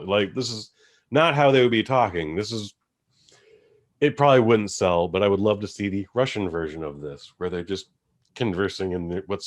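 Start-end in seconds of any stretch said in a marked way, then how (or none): none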